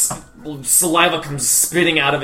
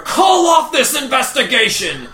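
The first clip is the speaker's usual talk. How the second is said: high-pitched forceful shout; yelling; 11 semitones higher, 3 dB louder than usual. Acted. Angry man upstairs demanding of his sister, yelling. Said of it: "he is yelling and says".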